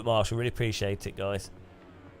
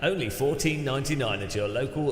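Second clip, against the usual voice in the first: lively TV ad voice, dropping into quick small print; mock TV commercial announcer; advert read; 2 semitones higher, 3 dB louder than usual. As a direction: sell it like a shopping channel presenter. Parodies a TV shopping advert.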